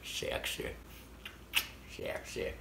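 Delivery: breathy mock-sultry whisper. After coughing he jokes his cough is sexy, whispered. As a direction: tease it under your breath.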